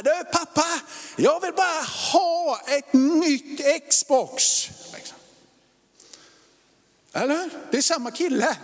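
pleading son shouting at dad; whiny kid voice. Retold or imitated. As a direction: beg for the Xbox like an impatient boy. Voicing pushy twelve-year-old son.

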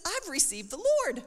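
high, drawn-out happy announcement, imitated; bubbly announcement. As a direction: announce the big news high and slowly. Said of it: "she was, like, all bubbly".

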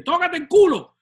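lightly voiced grandfather telling a kid off; grandfather's scolding. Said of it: "As my grandfather would say".

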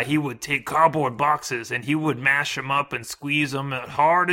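loud, creaky grandpa storyteller; old man voice. Retold or imitated. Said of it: "it's my old man voice".

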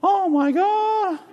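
very high, slow, stretched-out gasp of wonder; awestruck. Retold or imitated. People walking around for weeks, high slow awestruck gasp. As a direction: draw it out in amazed disbelief. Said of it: "they say it differently".

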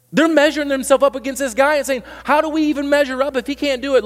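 high, loud pleading preacher; preaching at full force. Real, not acted. Preacher urging the church about the disciples' worry, high and loud. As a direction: drive it home high and loud.

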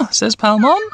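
ordinary delivery

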